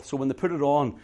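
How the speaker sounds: usual speaking voice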